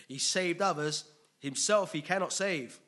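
jeering, loud taunt; mocking. Preacher reading the onlookers' jeers at Jesus, mocking. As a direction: read it as open mockery of a condemned man. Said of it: "they're mocking the guy".

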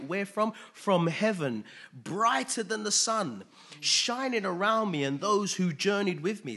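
rising, loud preacher's voice; preaching. Acted. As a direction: preach it out with building fervour. Preaching sermon.

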